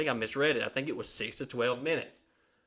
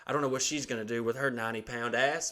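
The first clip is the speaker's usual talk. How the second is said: normal talking voice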